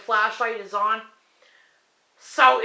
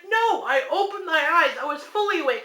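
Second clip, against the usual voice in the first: insistent; 7 semitones higher than usual. Retold or imitated. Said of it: "I'm like".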